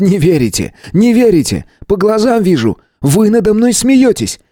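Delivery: high, overwrought voice rising to a near shriek; a paranoid man losing his grip; frantic earthling. A narrator's reading. Earthling accuses the stranger of mocking him, frantic and high.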